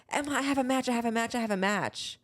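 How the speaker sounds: mock mother bursting in with news; imitating excited Mom. Mom coming upstairs announcing a match, excited. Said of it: "she's like".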